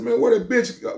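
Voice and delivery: high, quick puzzled ask; sudden wondering call; asking a friend where the woman went; puzzled question. Retold or imitated.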